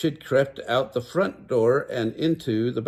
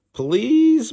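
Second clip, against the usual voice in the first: very high, loud drawn-out please; begging; 13 semitones higher, 8 dB louder than usual. Acted. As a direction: plead with everything you've got. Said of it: "begged Meg and Sean together".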